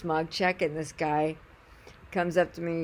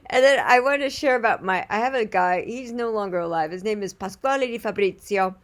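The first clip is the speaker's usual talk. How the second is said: usual speaking voice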